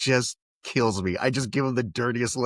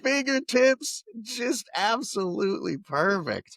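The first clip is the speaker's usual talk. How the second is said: slow, high-pitched rapture; mock sales pitch; 8 semitones higher, slower than usual. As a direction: sell the dream with drawn-out emphasis. Mock sales pitch for robot arms.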